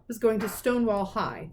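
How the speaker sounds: plain reading voice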